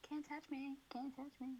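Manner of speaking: soft, high sing-song taunt; mock taunt. Friend mocks untouchable royals, sing-song. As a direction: tease like a child dodging.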